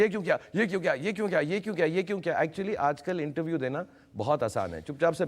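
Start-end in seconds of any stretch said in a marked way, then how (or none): 0.0-2.4 higher-pitched volley of repeated questions; relentless mock questioning, no room for answers; mock interviewer barrage. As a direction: imitate everyone firing questions at once. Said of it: "सभी बोलेंगे".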